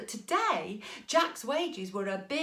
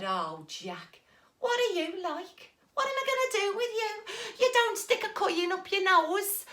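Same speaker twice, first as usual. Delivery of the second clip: exasperated mum; 9 semitones higher than usual. Acted.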